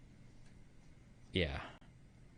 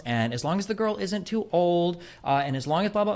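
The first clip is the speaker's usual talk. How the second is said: higher-pitched, drawn-out list of fussy conditions; mocking rule-lister; 7 semitones higher than usual. Poly partner lists conditions to a newcomer, mocking. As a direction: recite the caveats in a mocking higher voice. Voices a poly person listing rules.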